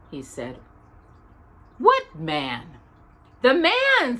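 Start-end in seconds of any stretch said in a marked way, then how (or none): 1.8-2.8 puzzled. A narrator's reading. Busy scene hand, puzzled. 3.4-4.2 patiently. A narrator's reading. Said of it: "said Paddington patiently".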